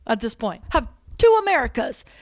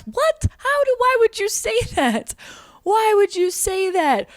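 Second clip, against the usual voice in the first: high-pitched, baffled outcry; incredulous; 7 semitones higher than usual. Acted. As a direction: stretch it out in exasperated disbelief. Incredulous reaction to absurd claim.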